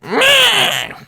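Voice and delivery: high, bored little croak; a crow's lazy caw. Acted. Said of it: "just be like".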